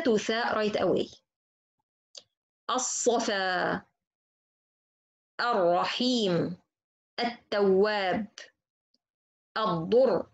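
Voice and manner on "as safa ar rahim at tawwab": slow, careful sun letter words, lam silent; slow drill words. Shown as a demonstration. Teacher drilling examples slowly for students. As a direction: draw out each word, doubling the first letter. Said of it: "Let's see examples".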